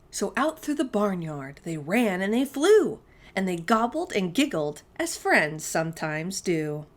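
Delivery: lilting rhyme read aloud; sing-song storytime voice; reading to children. A narrator's reading. Reads rhyming picture book aloud.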